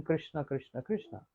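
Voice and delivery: soft, tender calling of Krishna's name; a parrot echoing Radharani's soft call; parrot mimicking Radharani. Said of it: "exactly in the same tone of Radharani".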